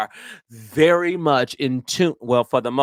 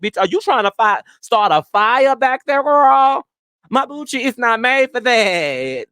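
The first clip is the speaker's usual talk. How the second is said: high outraged joke to her friend; indignant complaint; 9 semitones higher than usual. Real, not acted. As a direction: throw it out in outraged disbelief.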